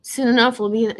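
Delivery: ordinary talk, nothing marked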